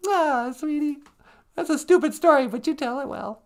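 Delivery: very high, cooing character voice; squeaky sidekick. Pretend. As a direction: console him in a high put-on voice. Sidekick voice consoles the storyteller.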